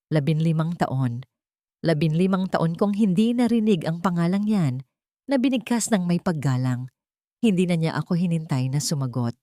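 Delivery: usual speaking voice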